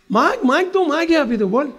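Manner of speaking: high-pitched, eager mock urging; lightly acted generous delight; delighted offer. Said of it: "હું તારી પર બહુ રાજી થઈ ગયો છું".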